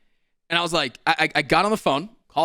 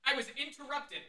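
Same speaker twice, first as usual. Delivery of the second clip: mock polite high voice; 8 semitones higher, 4 dB quieter than usual. Mocking the interruption on the phone.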